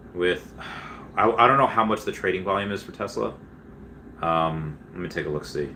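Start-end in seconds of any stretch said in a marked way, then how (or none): none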